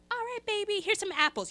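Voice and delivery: high, sweet mom voice offering apples; mom coaxing. Retold or imitated. Mother coaxing her screaming son with apples, high and sweet. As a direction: coax the toddler like a sugary mom. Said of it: "And she goes".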